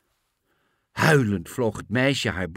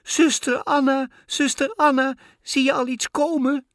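put-on very high, louder girl's cry; anxious call; 5 dB louder than usual. A narrator's reading. Girl calls up to her sister in the tower, anxious. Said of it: "Het meisje riep angstig naar boven".

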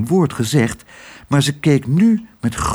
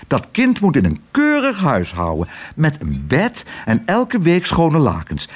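bossy fussy aunts. Acted. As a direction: insist on what the child needs. The aunts lecture Tos.

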